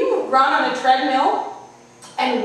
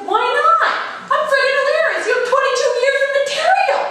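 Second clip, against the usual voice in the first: much higher and louder, half-sung mock dad; imitating dad; 12 semitones higher, 4 dB louder than usual. Dad boasting to his daughter, higher louder mock voice. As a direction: ham up your boastful dad. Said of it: "And he said".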